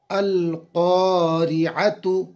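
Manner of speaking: अल क़ारिअतु chanted slowly, final tu clear; slow spelled recitation. Shown as a demonstration. Teacher completes the word for students, slow chant. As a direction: draw out अल क़ारिअतु, ending on a clear tu. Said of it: "ता पर पेश है तू".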